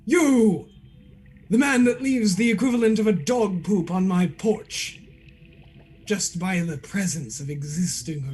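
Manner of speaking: grandly indignant; a hint of a pompous character; theatrical figure voice. Shadow then Tinby upside down.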